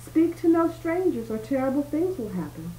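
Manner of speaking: storybook witch calling out a warning. A narrator's reading. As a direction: call a warning up to a window. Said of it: "She called up to the window".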